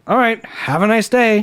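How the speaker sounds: retold sarcastic pleasantry; mockingly polite. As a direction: send them off with mocking sweetness. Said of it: "kinda, like, mockingly".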